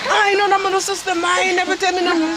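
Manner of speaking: high, fast, disbelieving son; rushed, high-pitched denial; indignant protest. Acted. Son protests news of a sister.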